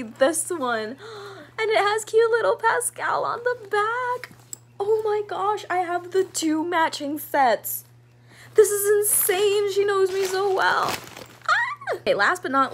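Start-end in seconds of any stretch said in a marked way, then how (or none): 1.0-1.5 high, breathy gasp; real gasp of delight; sharp gasp. Real, not acted. Seeing the matching bag. 1.6-10.9 can't contain the joy; real excited gushing; thrilled gushing. Real, not acted. Thrilled by the matching bag. 11.5-12.0 very high short squeal; real squeal; delighted squeal. Real, not acted. Overjoyed at the gift.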